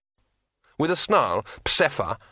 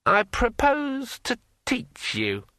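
high, slow, eerie ringing voice; like a finger round a wine glass rim; ringing metallic sword voice; 11 semitones higher, slower than usual. A narrator's reading. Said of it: "in a voice like the sound of a finger dragged".

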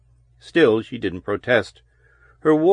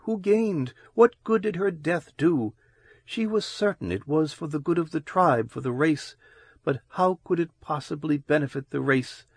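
put-on bitter young woman; 5 semitones higher, 5 dB quieter than usual. A narrator's reading. Loris voiced in narration.

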